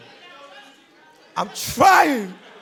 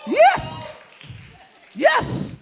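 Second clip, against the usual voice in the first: excited yes. Real, not acted. Excited arrival at club.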